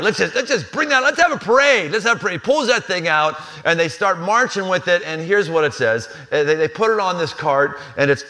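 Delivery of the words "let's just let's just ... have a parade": a hammed-up David cheering on the parade; eager David. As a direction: pitch it up like a giddy new king.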